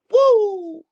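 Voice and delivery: loud high whoop; woo. Real, not acted. Storyteller celebrating the smoke session, loud high whoop. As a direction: whoop in triumph.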